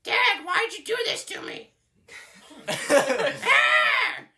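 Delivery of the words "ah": high, loud scream; angry scream. Acted. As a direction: scream in pained outrage. Screams after being hurt.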